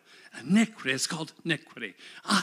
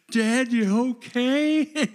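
higher, louder, slow call of a worried son; 7 semitones higher, 7 dB louder, slower than usual. Retold or imitated. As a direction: call out from another room. Son calling from bedroom.

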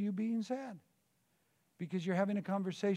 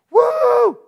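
a very high, loud whoop of joy; joyful whoop; 22 dB louder than usual. Acted. Leaving prayer overjoyed, a loud high whoop. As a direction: let out a burst of celebration.

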